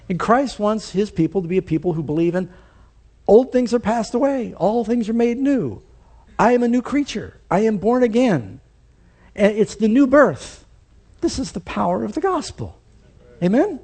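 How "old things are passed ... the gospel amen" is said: a lightly raised sermon climax, a bit higher; rising preaching. Acted. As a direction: build the preaching fervour up toward the Amen.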